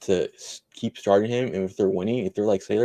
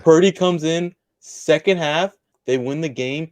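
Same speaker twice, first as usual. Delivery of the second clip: excited; 7 semitones higher, 7 dB louder than usual. Real, not acted. Bold prediction, hyped up.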